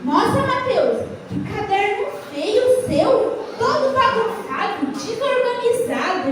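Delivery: teasing a classmate about his messy notebook, slow mocking voice. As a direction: drag it out to make fun of the messy notebook. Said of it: "começou a falar assim pro Mateus".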